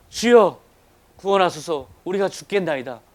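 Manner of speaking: loud opening call, easing off; retold desperate cry. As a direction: cry out to the Lord in preached quotation. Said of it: "그렇게 부르짖죠".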